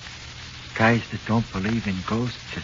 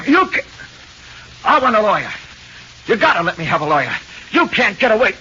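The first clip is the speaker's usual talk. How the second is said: rising panic; 9 semitones higher, faster than usual. Acted. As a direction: beg for a lawyer in terror. Suspect panics as he's dragged.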